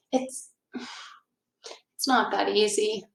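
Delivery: quiet defeated murmur; sad. Acted. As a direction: barely voice a sad doubt. Lucy's sad thought.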